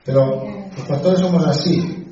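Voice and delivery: laughing. Real, not acted. Pastor laughing at himself before the congregation, laughing. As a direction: chuckle through the irony.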